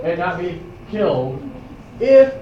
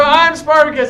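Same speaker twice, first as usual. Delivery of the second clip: loud, a little higher claim; loud declaration; 6 semitones higher, 8 dB louder than usual. Retold or imitated. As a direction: shout it with the crowd.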